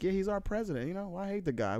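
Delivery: imitated strangers. Retelling strangers' talk about the president, imitated voice. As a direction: lift the voice to mimic them. Acted quote of strangers retold.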